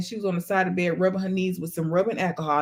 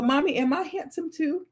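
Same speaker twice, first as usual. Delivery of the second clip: lightly voiced child, higher; a hint of a little boy fishing for praise; imitating son; 6 semitones higher than usual. Son asks Mommy if he's handsome, lightly imitated. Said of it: "He'll go".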